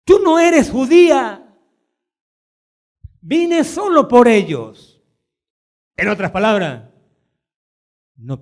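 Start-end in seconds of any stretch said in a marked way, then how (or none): 0.0-4.9 paraphrased rejection. Retold or imitated.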